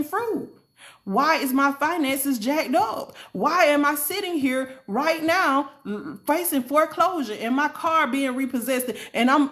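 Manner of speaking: bewildered pleading. Retold or imitated. Speaker questioning God about hard times, bewildered pleading. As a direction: plead in rising, stretched-out questions.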